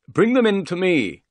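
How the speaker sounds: loud, high call to a servant. A narrator's reading. Man calls to his servant from inside the house, calling out. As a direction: call it out commandingly from another room. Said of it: "the voice called".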